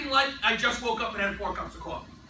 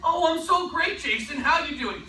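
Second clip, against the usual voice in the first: high, loud eager answer; enthusiastic reply; 5 semitones higher, 4 dB louder than usual. Retold or imitated. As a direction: answer like you can't wait to talk. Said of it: "If I call up Jason Manella".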